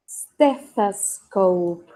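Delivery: "stethoscope" drawn out in three parts, ste-thas-kop; slow pronunciation model. Shown as a demonstration. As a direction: stretch "stethoscope" slowly so learners can copy it. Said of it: "Y se pronuncia". ("stethoscope" muy despacio, marcando cada sílaba)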